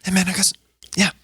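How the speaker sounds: high, halting comic impression; mock summons, pitched well up; Bobby. Bobby calling the comic over for a word, high imitation. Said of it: "yeah, that's Bobby Tucci".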